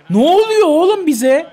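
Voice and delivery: high, loud, slow despairing cry; exasperated disbelief. Real, not acted. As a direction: wail at the team's collapse. Gol yedikten sonra isyan. (takıma çaresiz isyan)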